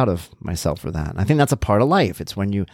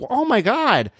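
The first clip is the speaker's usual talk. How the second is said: very high mock-appalled exclamation; retold dismay; 14 semitones higher, slower than usual. Dad learning what daughter thought, high appalled retelling. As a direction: exclaim it with pitch leaping up.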